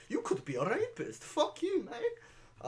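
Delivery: squeaky mocking voice breaking into a laugh; mocking high voice. Streamer mocking the TV reporter, high and laughing. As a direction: pitch way up and laugh through the insult. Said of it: "it's like".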